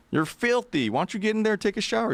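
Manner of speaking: put-on a high, pushy old man, fast; pushy host. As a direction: press him to get in the shower. Host voiced pushing Brian to shower.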